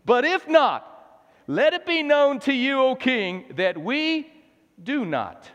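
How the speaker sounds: high, loud proclamation to a king; ringing refusal before the throne; defiant conviction. Acted. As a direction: declare it boldly, settling firmly at the end. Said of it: "as though we were standing before King Nebuchadnezzar".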